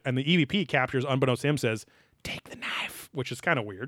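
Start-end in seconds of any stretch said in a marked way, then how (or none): 2.2-3.1 whisper. Retold or imitated. Quoting a whispered EVP.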